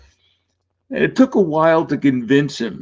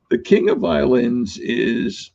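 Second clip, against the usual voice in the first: ordinary delivery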